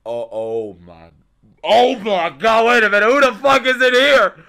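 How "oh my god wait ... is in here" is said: real hype at the screen; stunned shout. Real, not acted. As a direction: demand to know who it is. Stunned by a mystery summon.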